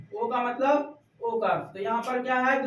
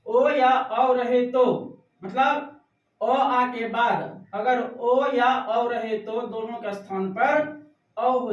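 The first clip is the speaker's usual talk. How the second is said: slow dictation; slower than usual. Shown as a demonstration. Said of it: "teacher dictating the rule".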